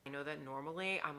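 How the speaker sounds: usual speaking voice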